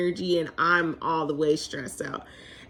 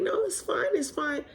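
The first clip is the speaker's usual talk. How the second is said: breezy reassurance; 8 semitones higher, 7 dB quieter than usual. Retold or imitated. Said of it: "He's like".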